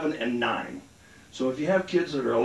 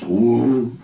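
stoner whoa; 4 semitones lower, 9 dB louder than usual. Retold or imitated. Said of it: "the smoke goes".

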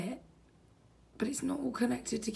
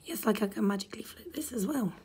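whispered sarcasm, softer; mock the model under the breath; sarcastic whisper; 4 dB quieter than usual. Real, not acted. Builder mocks the Lego, sarcastic whisper.

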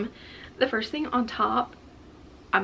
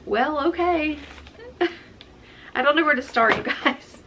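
overwhelmed; 7 semitones higher than usual. Real, not acted. Overwhelmed by the gifts.

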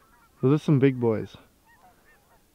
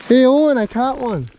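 excited call; 9 semitones higher, 3 dB louder than usual. Real, not acted. Calling to friend across ice.

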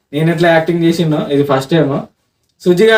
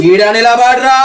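raised, higher-pitched order; forceful menacing command; 5 semitones higher, slower than usual. Acted. Prankster orders the boy to stand, forceful menace. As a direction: repeat the order with mounting force.

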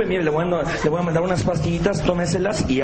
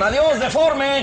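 high, drawn-out street heckle; jeering holler; shouted taunt; 7 semitones higher, much slower than usual. Acted. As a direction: call it out slowly to someone across the street. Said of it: "le gritan".

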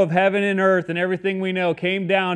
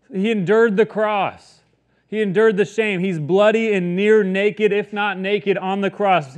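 normal talking voice

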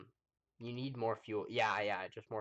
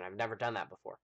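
ordinary talk

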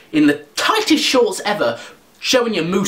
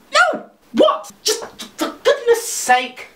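exasperated squeak. Pretend.

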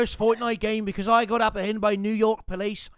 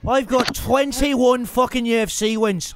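cocky mock Irish accent, lightly done; a gamer playing brash Irish fighter Conor McGregor; swaggering put-on Irish brogue. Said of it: "like your voice".

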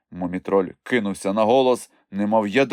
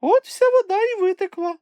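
very high, louder creature voice; squeaky explanation; 5 dB louder than usual. A narrator's reading. Chmykh explains the empty lake, squeaky voice. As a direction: pipe it out in falsetto. Chmykh's voice continues his guess.

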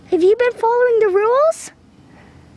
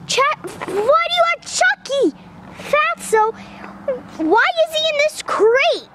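high, playful scolding; mock scolding the dog; 6 semitones higher than usual. Real, not acted. Owner scolding his dog Fatso over Chucky, high playful voice.

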